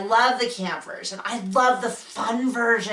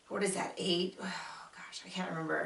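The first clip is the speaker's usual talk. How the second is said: unvoiced whisper, much softer, to herself; whispered aside; 2 semitones lower, 13 dB quieter than usual. Real, not acted. Can't recall number, aside.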